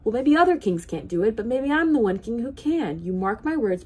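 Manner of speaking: loud furious royal shout; raging king. Acted. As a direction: rage at the boy, full voice. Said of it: "flew into a terrible rage".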